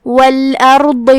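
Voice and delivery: والارض with a full, heavy r and daad, mouth rounded; slow chanted والارض, emphatic letters thick. Shown as a demonstration. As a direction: puff the cheeks and say والارض heavily. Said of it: "دونوں کو بھاری آواز میں منہ پھلا کے پڑھیں گے".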